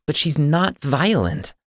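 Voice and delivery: high, loud and slow, holding her ground. Acted. Andrea tells Y about her sister's gifts, high and insistent. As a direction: insist on every word.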